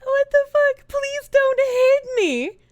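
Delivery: high, startled mock plea; shocked mimicry. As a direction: echo it in a high, drawn-out mock voice. Startled, mimics the message just played.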